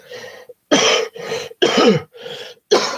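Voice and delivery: harsh cough from a cold; coughing fit. Real, not acted. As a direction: cough hard, chesty. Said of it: "Sorry about the coughing".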